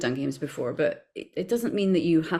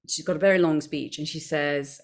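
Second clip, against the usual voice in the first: normal talking voice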